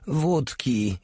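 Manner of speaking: high mock groaning plea. A narrator's reading. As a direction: moan for vodka. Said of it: "jęknął Porta". (udawany jęk o wódkę)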